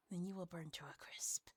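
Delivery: soft whispered threat; threatening whisper. Acted. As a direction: warn him under your breath. Warning to the vampire.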